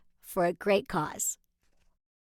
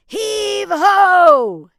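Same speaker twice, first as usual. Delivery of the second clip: loud high pirate heave; hearty pirate call; 8 semitones higher, 14 dB louder than usual. Acted. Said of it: "Use your best pirate voice".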